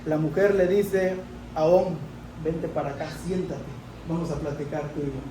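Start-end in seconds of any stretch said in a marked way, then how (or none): 2.4-5.2 soft, quick, hushed invitation to sit down; coaxing wife. Acted. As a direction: draw him in softly like a wife calming her husband.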